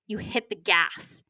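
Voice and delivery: ordinary delivery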